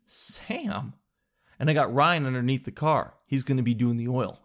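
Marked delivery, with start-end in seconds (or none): 0.1-0.9 high, soft wonder; small high-pitched disbelief; mock awed echo. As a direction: repeat the name in hushed mock amazement. Retold awe at being introduced.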